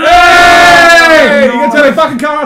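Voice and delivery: loud high yelling; frustrated shouting. Real, not acted. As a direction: yell at the game. Car crash chaos.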